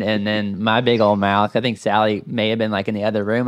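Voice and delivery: ordinary delivery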